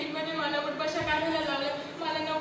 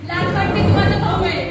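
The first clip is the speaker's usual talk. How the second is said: louder, hurt outburst at her brother; ashamed angry cry; 3 semitones higher, 11 dB louder than usual. Acted. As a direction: fling the shame at him. Sister shames her brother. (भावावर दुखावलेली, रागाची मोठी हाक)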